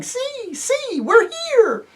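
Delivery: very high, slow little squeak; squealing with joy. Acted. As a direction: squeak it like tiny excited mice. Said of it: "squealing with joy".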